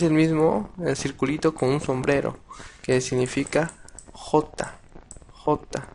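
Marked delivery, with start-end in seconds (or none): 4.1-5.9 "J" as Spanish "jota", with a raspy h-like onset; demonstrating pronunciation. Shown as a demonstration. Teacher explains the circle with a hat, "J" pronounced as "jota". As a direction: say "J" as "jota", separately each time. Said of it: "que se pronuncia J".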